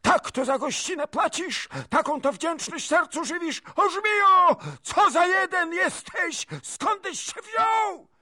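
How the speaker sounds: very high, loud furious tirade; screeching noblewoman, volume at full tilt; princess screaming. A narrator's reading. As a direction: shriek each question as an attack. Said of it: "krzyknęła kniachini".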